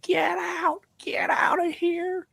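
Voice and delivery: high, breathy crying shout; mock sobbing scream; sobbing yell. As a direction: cry it out through tears, imitating.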